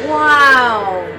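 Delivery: loud, drawn-out wow effect; vocal sound effect. Pretend. Vlogger adding own sound effects, blaring wow. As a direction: blare it out like a homemade sound effect. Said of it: "so bad with the sound effects".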